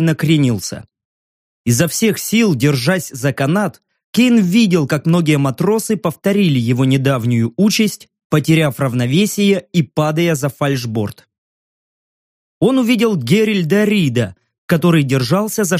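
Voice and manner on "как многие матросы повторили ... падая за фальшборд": plain reading voice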